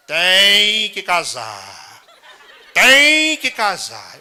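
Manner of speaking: very slow, tolling bell chant. Retold or imitated. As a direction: toll each word like a church bell. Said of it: "o que foi que ele disse". (o sino badalando bem devagar: tem que casar)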